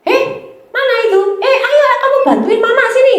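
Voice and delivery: high, laughing bossy mum calling; mother's voice. Retold or imitated. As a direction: call the kid over like a busy mother, with a laugh. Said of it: "Atau misalnya".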